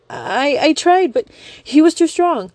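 high, slow, faltering excuse; whimpering high lie. Acted. Young woman stammering excuse.

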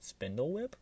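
a playful fake guess, pitch climbing; feigned puzzlement. A narrator's reading. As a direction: pretend you have no idea. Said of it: "he forced a puzzled expression".

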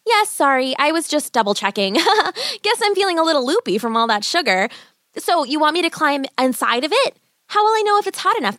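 put-on chatty, high child's voice with a giggle; stalling girl. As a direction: babble innocently to buy time. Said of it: "She decided to stall".

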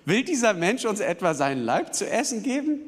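high-pitched, incredulous challenge; heated dispute. Acted. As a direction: act out an outraged questioner in an argument. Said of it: "einer heftigen Auseinandersetzung". (aufgebracht und ungläubig)

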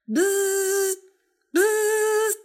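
high, loud buzzing bee sound; doorbell buzz. Acted. Matilda ringing Miss Totsie's doorbell, a loud high buzz. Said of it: "pushed the doorbell again".

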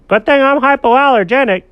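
high, loud cat boast; loud high-pitched self-satisfied cat; boasting cat character. Acted. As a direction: brag as a storybook cat.

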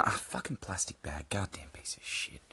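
whispered swearing under his breath; cursing a plastic bag quietly to himself; muttered curse. Real, not acted. Podcaster swearing at a rustling plastic bag, whispered.

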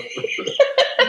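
airy squeaky laugh; breathy high laugh. Real, not acted. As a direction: wheeze out a giggle. Laughing at himself.

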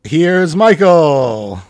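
slow, loud announcer call; grand entrance announcer. Pretend. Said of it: "tell me your entrance to the room now".

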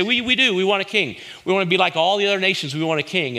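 high, pleading insistence; whiny demanding crowd. Retold or imitated. The people nag Samuel for a king, high and whining. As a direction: beg for a king like spoiled children.

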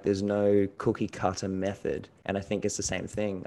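ordinary talk

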